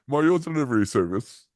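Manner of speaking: high, loud hammed-up Mario voice; bad Italian plumber accent. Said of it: "I'm not gonna do an Italian accent anymore".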